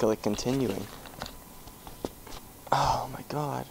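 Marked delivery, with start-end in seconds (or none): 2.7-3.7 whisper. Real, not acted. Whispering after the scare.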